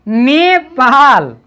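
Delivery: Nepal drawn out syllable by syllable; slow model reading. Shown as a demonstration. Teacher modelling Nepal slowly for child. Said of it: "ये क्या हुआ".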